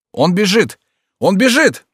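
high alarm shout. A narrator's reading. Sentries spotting Henry fleeing, alarm shout. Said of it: "крича".

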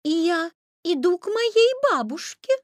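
put-on high, polite little-girl voice. A narrator's reading. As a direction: answer politely, pitched well up. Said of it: "ответила Красная Шапочка вежливо".

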